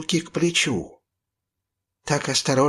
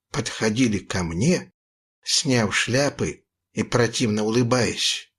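plain reading voice

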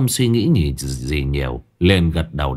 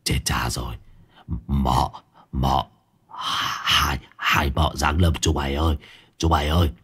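hushed, much lower and softer panicked whisper; terrified whisper; 7 semitones lower, 4 dB quieter than usual. A narrator's reading. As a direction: whisper like a mouse that's spotted the cat. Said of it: "kinh cứ như là chuột nhìn thấy mèo".

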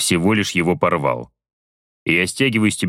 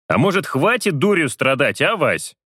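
serious; 7 semitones higher than usual. Acted. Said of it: "Вполне серьёзно произношу я".

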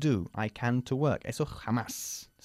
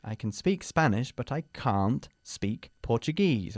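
slow model sentence. Shown as a demonstration. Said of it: "model answer".

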